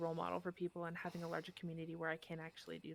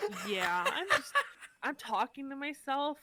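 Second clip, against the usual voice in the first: laughing embarrassed excuse; 4 semitones higher, 8 dB louder than usual. Retold or imitated. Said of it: "embarrassing to be like".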